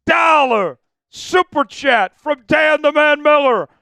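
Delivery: loud, high, slow announcer shout; hype announcement. Real, not acted. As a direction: drag out each word like a ring announcer. Announcing a big super chat.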